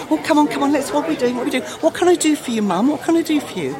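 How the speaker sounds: lightly voiced eager-to-please dog; a hint of an excited pup. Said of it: "He's just saying".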